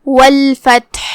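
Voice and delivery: slow والفتح with a clear breathy ح from mid-throat; والفتح with a clearly released, airy ح at the end. Shown as a demonstration. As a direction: let the final ح of والفتح sound fully from the throat. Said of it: "ہا کو وہیں سے پڑھیں گے".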